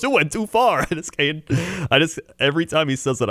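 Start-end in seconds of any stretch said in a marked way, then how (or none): 0.0-0.8 higher punched Chicago ad voice; Chicago guy. Retold or imitated. Comic mimicking a radio commercial guy, high Chicago accent. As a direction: deliver the catchphrase in mock Chicago. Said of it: "every time he goes".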